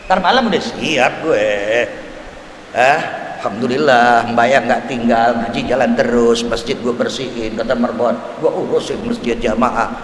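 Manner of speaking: lightly voiced contented old man, low and relaxed; a hint of a calm devout elder saying he is ready; pious man's boast.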